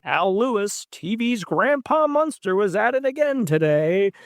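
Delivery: news anchor voice. Retold or imitated. Mimicking local news report.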